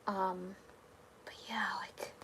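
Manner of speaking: soft whispered aside to chat as the stream winds down. Real, not acted. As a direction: let it drop to a whisper. Trailing off at stream end.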